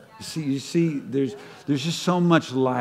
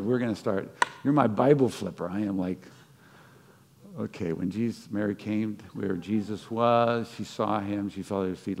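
ordinary delivery

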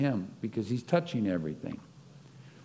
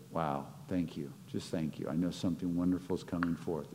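hushed thanks; 4 semitones lower, 8 dB quieter, faster than usual. Pretend. Quoted grateful inner posture.

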